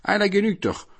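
high-pitched delight; pleased judge, voice lifted high. A narrator's reading. Kadi to villagers on finding someone to punish, pleased. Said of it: "Der Kadi freute sich".

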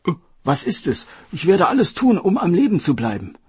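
hopeful outburst from the dragon king; eager king. Acted. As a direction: grab at the cure, fast and breathless.

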